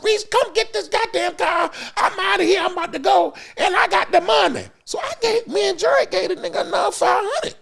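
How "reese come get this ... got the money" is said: high, loud angry rant; much higher mock furious driver; cussing out. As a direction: yell at the boss in a high rage. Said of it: "he cussing Reese out".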